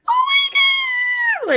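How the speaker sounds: very high, slow, loud squeal of Oh my God; squealing like a pig. Retold or imitated. Wife replaying her shocked squeal at husband's surprise, very high squeal. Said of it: "squealing like a pig".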